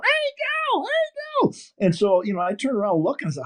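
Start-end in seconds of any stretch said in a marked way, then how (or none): 0.0-1.5 high-pitched call across the airport. Retold or imitated. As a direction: hail him from far off, very high. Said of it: "he's yelling".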